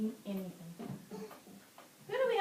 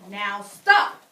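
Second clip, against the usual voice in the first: loud, firm command; stern order; 3 semitones lower, 15 dB louder than usual. A narrator's reading. Teacher as Max stopping the wild things, stern. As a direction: halt the party with authority. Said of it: "Max said".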